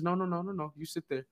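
softly imitating a humble godfather; gently decline, dropping away softly at the end; quiet, humble man. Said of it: "Real quiet, humble dude, right?".

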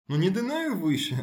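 put-on the wrong way: a parent yelling at an upset child; mock exasperated shout. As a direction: snap at the stomping child in mock irritation. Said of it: "to jest zła droga".

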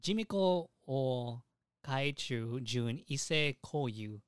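normal talking voice

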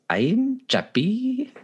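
very high, slow robot voice; a corny robot announcing itself; Chappie robot voice. Retold or imitated. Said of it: "the robot goes".

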